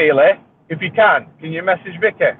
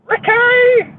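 very high squeaky call; hammed-up high call; mock call; 3 dB louder than usual.

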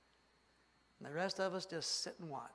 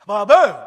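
much louder booming blast sound; explosion noise; 5 semitones higher, 28 dB louder than usual. Acted. Preacher acting out the diesel bus blowing smoke, booming blast. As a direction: shout it like a grenade going off.

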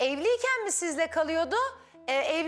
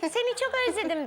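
put-on higher, fast girlish voice; woman's voice; 7 semitones higher, faster than usual. Shown as a demonstration. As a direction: sing it out like a woman missing someone. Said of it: "Kadın gibi, kadın sesi yap böyle".

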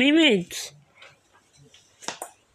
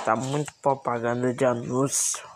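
whisper; 9 semitones lower, 7 dB quieter than usual. Real, not acted. Grumbling about ads.

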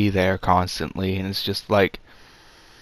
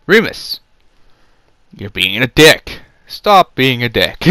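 mock scolding; 2 semitones higher, 6 dB louder than usual. Harry scolding Remus, retold.

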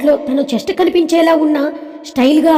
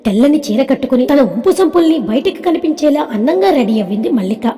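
ordinary delivery

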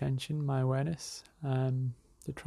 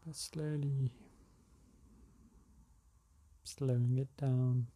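calm, unhurried meditation guide; spacious, settled relaxation-exercise delivery; guided meditation. Acted. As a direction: ease them into slowing the breath, with long pauses.

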